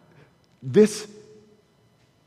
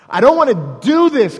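louder, strained pleading; anguished plea; 5 semitones higher, 8 dB louder than usual. Retold or imitated. Preacher voicing Jesus refusing the cup, anguished. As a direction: cry it out in anguish.

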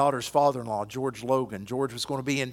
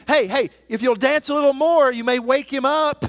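loud, higher jeering mockery; mocking taunt; 10 semitones higher, 9 dB louder than usual. Elijah taunting Baal's prophets, loud scornful mockery. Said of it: "Elijah starts mocking them".

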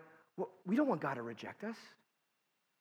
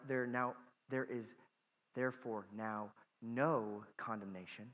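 "no" given quiet weight; stress on no; 8 semitones lower, 9 dB quieter than usual. Shown as a demonstration. Pastor explaining the verse: emphatic "no". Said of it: "the no is emphatic".

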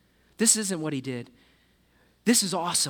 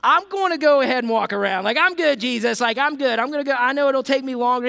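loud, higher, hammed-up nervous backing out; reluctant disciple; 6 semitones higher, 7 dB louder than usual. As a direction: laugh it off and dodge the boat. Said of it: "And they're like".

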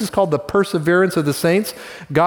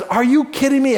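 a king furious at the injustice; indignant disbelief; livid outburst; 9 semitones higher than usual. Retold or imitated. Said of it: "And he's livid".

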